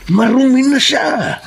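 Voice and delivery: high, slow, spiteful jab; contemptuous sneer. Acted. Denver telling the husband his wife is tainted, sneering. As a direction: spit it out with contempt.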